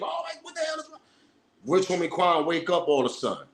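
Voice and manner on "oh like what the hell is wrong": high, shrill-pitched mock screaming; imitated scream. Girlfriend coming downstairs, imitated high panicked scream. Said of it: "she come downstairs screaming".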